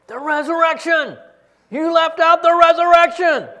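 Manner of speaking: a professor roaring at a student in a tiny office; furious yell. Retold or imitated. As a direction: bellow it like an outraged professor. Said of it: "that professor yelled at me".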